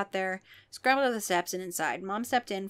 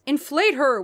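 loud, high yell; 5 semitones higher, 8 dB louder than usual. Acted. A horror yelling at the crowd, loud. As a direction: bark the order at the crowd. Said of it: "one of them yelled".

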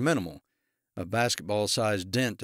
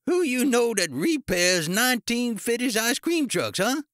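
high, loud, scornful character voice; angry challenge; 13 semitones higher, 5 dB louder than usual. A narrator's reading. Ice cream man to officer who suggested repairs, outraged. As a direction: fire the question out high and indignant.

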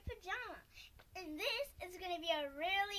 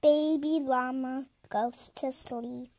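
loud, sing-song storybook reading; a child's eager bedtime-story read-aloud; reading a picture book; 17 dB louder, slower than usual. Acted. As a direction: read it out proudly to your viewers.

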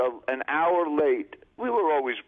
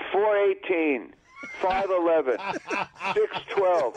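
Larry's odd times; 3 semitones higher, slower than usual. Retold or imitated. Imitating Larry's odd dinner times.

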